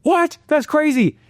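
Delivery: high, stunned disbelief; stunned audience. Retold or imitated. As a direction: gasp in disbelief. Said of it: "everyone was like".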